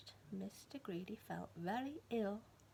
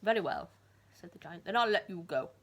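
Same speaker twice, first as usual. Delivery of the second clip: giant voice; 3 semitones lower, 9 dB louder than usual. Acted. Giant speaking.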